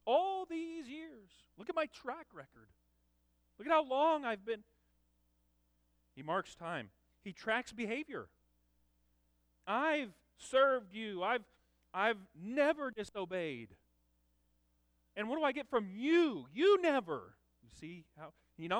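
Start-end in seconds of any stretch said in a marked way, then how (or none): 0.1-4.6 indignant son. Acted. Older son to his father, indignant and rising. Preacher voicing son's complaint. 9.7-17.4 higher, louder, slow resentful outcry; indignant complaint. Acted. Older son accusing his father, rising to a shout. Son's complaint voiced again.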